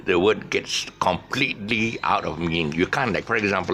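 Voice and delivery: ordinary delivery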